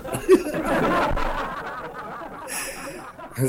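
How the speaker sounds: high, wheezing laugh that falls away; drawn-out high laughter; long laugh. Real, not acted. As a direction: crack up at your own joke. Laughs at own English.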